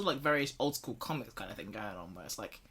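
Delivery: usual speaking voice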